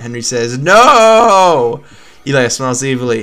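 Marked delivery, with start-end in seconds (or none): 0.6-1.8 loud cry. Acted. Henry cries out too late.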